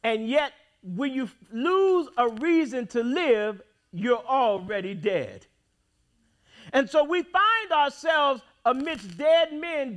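ordinary talk

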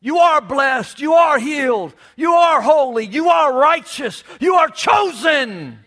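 each "You are" hit loud and slow; shouted declaration. Real, not acted. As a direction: proclaim it over the congregation. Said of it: "That's our confession today".

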